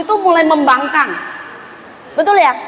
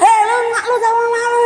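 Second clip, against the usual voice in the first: put-on higher, fast snapping at the kid; mock angry scolding; 8 semitones higher, faster than usual. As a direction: bark at the child, hammed up. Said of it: "gak perlu bilang". (orang tua membentak anak, dibuat-buat)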